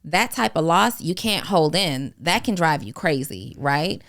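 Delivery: normal talking voice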